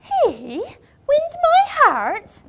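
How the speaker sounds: indignant disbelief. Acted. Indignant retort in quarrel.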